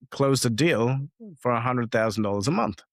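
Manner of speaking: usual speaking voice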